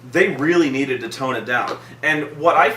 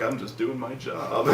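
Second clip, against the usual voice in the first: very soft, lower, laughing mumble; mocking Superman's modesty, soft and laughing; mock modest Superman; 4 semitones lower, 10 dB quieter than usual.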